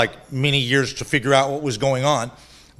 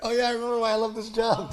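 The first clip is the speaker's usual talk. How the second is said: laughing repeat; 6 semitones higher, 6 dB quieter, faster than usual. Retold or imitated.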